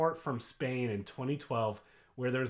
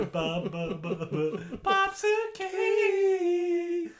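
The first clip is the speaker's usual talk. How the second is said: high laughing chant; 3 dB louder, much slower than usual. Pretend. Mimics the bob, rising.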